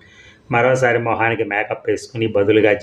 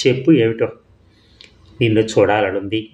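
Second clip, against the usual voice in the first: plain reading voice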